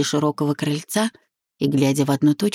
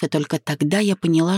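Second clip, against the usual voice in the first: ordinary delivery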